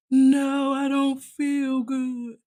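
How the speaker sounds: sick sister whine. Retold or imitated. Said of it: "We said".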